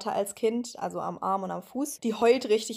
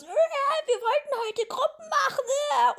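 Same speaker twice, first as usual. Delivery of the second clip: very high, slow, whiny mock shriek; mocking Solin's shrieking; slower than usual. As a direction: ape the annoying housemate's screech. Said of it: "Und dann schreit die so". (nachgeäffter schriller Schrei, sehr hoch und gedehnt)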